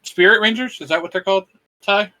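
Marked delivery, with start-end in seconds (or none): none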